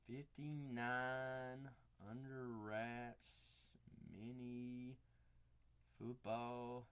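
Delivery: slow, soft, drawn out while writing; dictating to yourself while writing; spelling it out. Real, not acted. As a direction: say each word slowly as you write it. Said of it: "Wow, I can spell".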